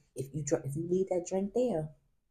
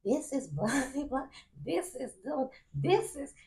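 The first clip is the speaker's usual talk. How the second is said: Facebook Live friend; 8 semitones higher than usual. Retold or imitated. Friend broadcasting the traffic stop to viewers, hammed-up. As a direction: narrate the stop for the camera. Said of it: "He like".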